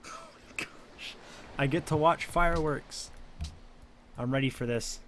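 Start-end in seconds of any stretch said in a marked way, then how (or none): 0.1-1.2 breathy awed whisper. Real, not acted. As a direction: hush it in awe.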